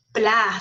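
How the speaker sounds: 'pla' held out on its own; stressed syllable. Shown as a demonstration. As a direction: lean the voice on 'pla'. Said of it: "En pla cargo la voz". ('pla' con la voz cargada)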